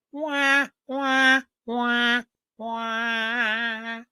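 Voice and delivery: high, fake wailing wah wah; mock bawling like a baby over the eggs. Joking to viewers about the chicken, a high fake baby wail.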